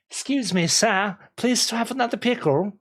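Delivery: high, pleading peasant beggar; peasant begging voice. Pretend. Streamer playing a Renfest peasant begging for a pickle. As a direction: beg sweetly like a lowly peasant. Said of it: "I was begging for trinkets and pickles".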